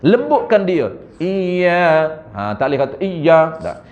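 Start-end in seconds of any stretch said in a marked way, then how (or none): none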